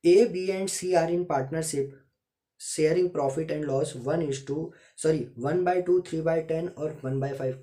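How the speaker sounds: slow, deliberate classroom dictation. Shown as a demonstration. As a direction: dictate it slowly so students can write. Reads question slowly, dictation style.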